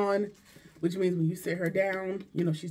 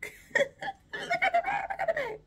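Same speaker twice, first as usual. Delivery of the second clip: laughing. Real, not acted. Delighted unboxing.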